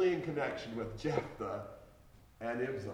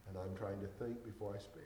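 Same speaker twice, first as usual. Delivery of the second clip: ordinary talk, nothing marked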